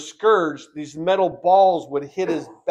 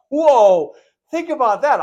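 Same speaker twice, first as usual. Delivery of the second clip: loud and high, in wonder; a loud burst of amazement to the congregation; amazed exclamation; 8 semitones higher, 7 dB louder than usual. Real, not acted. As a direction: marvel out loud at the idea. Preacher marvels at scripture.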